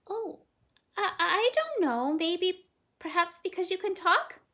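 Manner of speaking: put-on high, hesitant little girl; shy girl. A narrator's reading. Girl answering the parrot, shy and hesitant. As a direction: stammer shyly with a small laugh.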